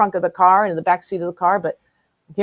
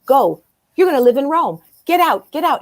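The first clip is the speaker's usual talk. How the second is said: higher, louder, pushing her out the door; urgent shouting psychic; 6 semitones higher, 5 dB louder than usual. Retold or imitated. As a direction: bark the orders to leave, rising.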